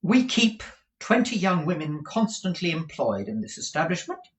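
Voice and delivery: high-pitched, businesslike madam; brisk Madame Mantalini. Acted. Madam describes her establishment to Kate, high and proper.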